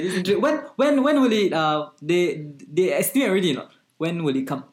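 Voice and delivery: ordinary talk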